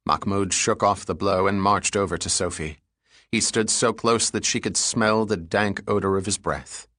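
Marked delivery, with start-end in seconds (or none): none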